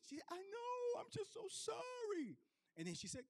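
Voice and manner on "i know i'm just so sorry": high, soft teary apology; high crying nurse voice; mock tearful nurse. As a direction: sob out the sorry like a sympathetic nurse.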